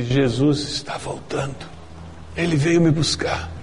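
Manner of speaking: imitating a dying woman's raspy, smothered voice; husky, choked imitation of a throat-cancer patient; hoarse, muffled voice. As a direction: push the words out through sick vocal cords. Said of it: "com aquela voz rouca e abafada".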